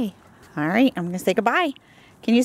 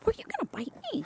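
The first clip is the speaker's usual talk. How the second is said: hushed mock alarm; 10 semitones higher, 13 dB quieter, much faster than usual. Mock alarm at puppy nibbling.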